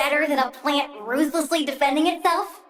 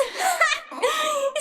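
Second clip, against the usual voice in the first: high, gleeful villainous cackle; shrill triumphant laugh; 9 semitones higher than usual. Acted. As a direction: cackle with delight at your power. Villain delighting in full bursts.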